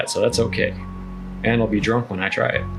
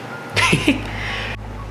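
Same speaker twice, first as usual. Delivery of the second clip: light high laugh under the breath. Real, not acted. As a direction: giggle at your own joke. Laugh after joking about veggies.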